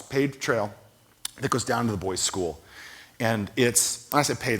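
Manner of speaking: ordinary talk, nothing marked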